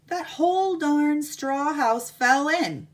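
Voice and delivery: loud, slow, dramatic reveal. Acted. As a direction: land the collapse big.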